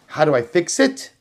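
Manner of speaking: usual speaking voice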